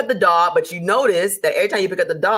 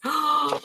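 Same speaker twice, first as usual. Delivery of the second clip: sharp, higher shocked intake of breath; mock gasp; 9 semitones higher, 4 dB quieter than usual. Viewer told to raise prices, mock shocked gasp. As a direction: gasp as if the idea is outrageous.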